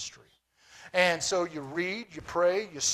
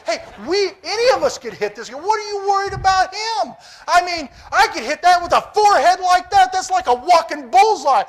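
much higher and louder pulpit excitement; preaching at full force; 12 semitones higher, 14 dB louder than usual. Acted. Pastor preaching David's bold confidence to the church, full force. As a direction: whip the crowd up about the giant.

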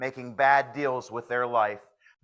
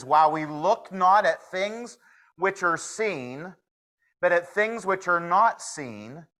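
scripture reading; 4 semitones higher than usual. Acted. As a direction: read the Bible verse with weight. Reads scripture aloud.

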